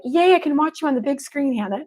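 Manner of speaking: imitating a gushing viewer calling out the host's name; excited fan voice. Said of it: "you're like".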